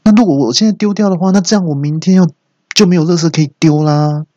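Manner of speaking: high, hurried grumbling reply; displeased retort. Acted. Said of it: "有点不高兴的回说".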